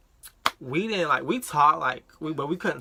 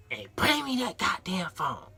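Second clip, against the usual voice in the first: mother's voice; 7 semitones higher, slower than usual. Retold or imitated. Mother demanding the phone.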